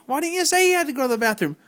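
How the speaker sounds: normal talking voice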